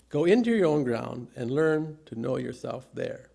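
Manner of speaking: ordinary talk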